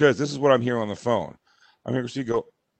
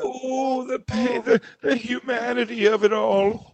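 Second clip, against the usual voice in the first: high, slow theatrical lament; mock suffering diva; 9 semitones higher, slower than usual. Husband mocks wife on the chaise lounge, theatrical lament. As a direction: wail it like a hammed-up tragic heroine. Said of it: "leaning over like Godiva".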